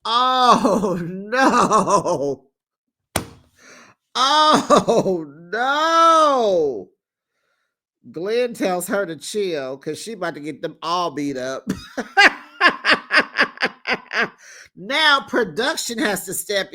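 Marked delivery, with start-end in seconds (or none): 0.0-6.9 aghast. Real, not acted. Host reacts to fight. 11.7-12.4 squealing laugh up high; real shrieking laugh. Real, not acted. Host laughs at scene.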